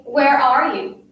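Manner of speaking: slow, loud call with a falling end. A narrator's reading. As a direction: call out for someone to hear. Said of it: "Say it loud enough".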